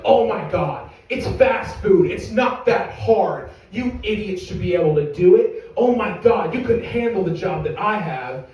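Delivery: berating wife. Acted. Customer's wife berates fast-food staff, indignant.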